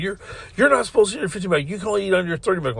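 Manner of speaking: imitated manager, high and rushed; frantic panic. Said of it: "in a frantic, like, panic".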